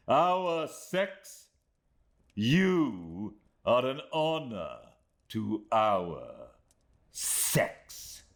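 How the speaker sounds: slow, icy scorn of a proud woman; a hint of a cold, contemptuous lady; mild scorn. Rosa scorns Emily.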